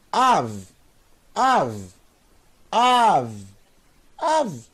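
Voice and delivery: Av in one syllable, ending on a bare V; last letter joined on. Shown as a demonstration. Teacher modelling a Hebrew word for learners, Av as one syllable. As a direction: repeat Av with the V closing the syllable. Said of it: "ler a última letra junto".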